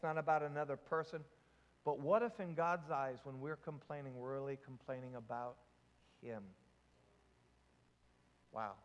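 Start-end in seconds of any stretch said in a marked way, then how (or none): 1.8-8.7 low, soft, sobering question; solemn hush. Real, not acted. Preacher asking congregation about God's eyes, hushed and solemn. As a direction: let it sink to a near-hush.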